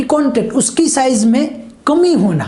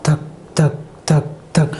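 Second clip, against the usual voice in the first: heartbeat imitation; 7 semitones lower, 3 dB quieter than usual. Shown as a demonstration. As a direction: let each धक fall like a pulse. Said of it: "ये जो heartbeat आपको यहाँ पर महसूस हो रही है". (धीमी, गहरी धड़कन जैसी धक)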